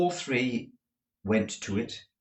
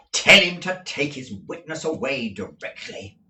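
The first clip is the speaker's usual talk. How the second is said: louder disgusted command; extreme disgust; 5 semitones higher, 7 dB louder than usual. A narrator's reading. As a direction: spit out the order. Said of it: "in extreme disgust".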